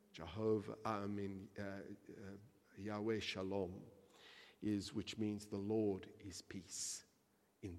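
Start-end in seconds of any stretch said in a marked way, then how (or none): none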